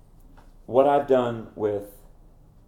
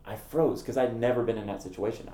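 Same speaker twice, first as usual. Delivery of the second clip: ordinary delivery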